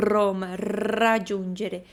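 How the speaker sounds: "Roma, raggiungere" with very long rolled r's, slow; exaggerated trilled R. Shown as a demonstration. Teacher shows what's overkill, exaggerated trill. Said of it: "Óbvio que a gente não vai falar".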